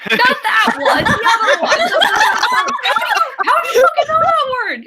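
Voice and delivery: high, laughing disbelief; scandalized outburst. Acted. Scandalised outburst at child's swearing.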